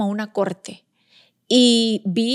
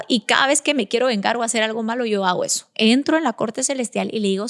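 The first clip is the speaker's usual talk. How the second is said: usual speaking voice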